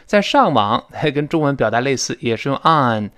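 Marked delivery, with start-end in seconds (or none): none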